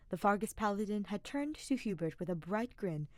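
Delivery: plain reading voice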